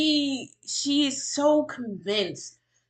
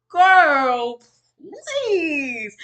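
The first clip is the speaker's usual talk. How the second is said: exasperated dismissal; 5 semitones higher, 6 dB louder than usual. Real, not acted. Dismisses the claimed diagnosis.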